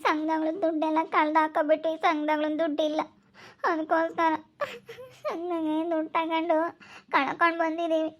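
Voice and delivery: crying through the explanation, breaking off between phrases; halting, tearful confession that sinks into sobs. Acted. Explaining the lost group money to a worried visitor, crying. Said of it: "ಯಾಕೆ ಹಿಂಗ್ ಅಳ್ತಾ ಇದೀಯಾ".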